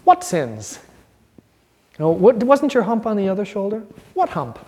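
lightly voiced Igor, mock puzzled; a hint of the hunchback servant asking innocently. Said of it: "like Igor".

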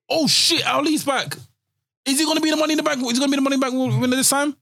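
high, thrilled, a bit rushed; excited fan reaction. Pretend. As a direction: gasp like the crowd just popped. Imagining the surprise return.

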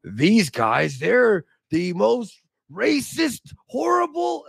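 high, slow mock outrage; a mocking imitation of pearl-clutching critics. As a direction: parrot the critics in a shrill mocking pitch. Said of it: "It's like they go".

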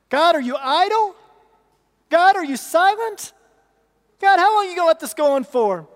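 loud, high desperate plea to God; pleading cry to God. Acted. Preacher voicing Habakkuk's complaint.

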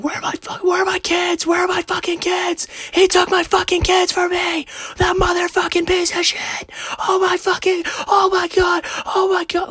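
strained, very high mock scream; imitated frantic screaming. Man imitates neighbor whose kids were taken, frantic high scream. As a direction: mimic a mother shrieking in panic. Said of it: "she opens the door and is screaming".

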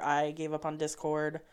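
ordinary talk

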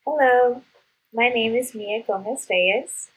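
ordinary delivery

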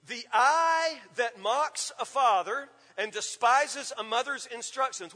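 slow, pointed verse reading; slow emphatic reading. Shown as a demonstration. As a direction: read the verse slowly, leaning on eye. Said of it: "What are we talking about here?".

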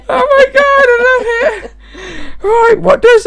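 high, loud, tearful laughing voice; helpless laughter. Real, not acted. Girlfriend trying to continue the game, helpless with laughter. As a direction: talk through real shrieking laughter.

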